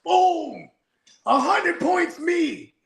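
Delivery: high, slow victory call; triumphant hype. Real, not acted. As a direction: gloat over the point. Celebrating his own joke.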